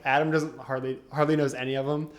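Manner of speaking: normal talking voice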